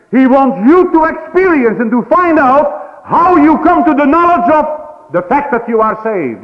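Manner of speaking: fervent preacher's cry; preaching at full force. Acted. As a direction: drive it home from the pulpit.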